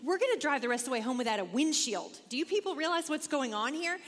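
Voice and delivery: mock panic at the family in the hail; frantic. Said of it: "And I'm thinking".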